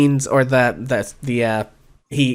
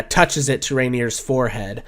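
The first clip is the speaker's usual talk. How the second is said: usual speaking voice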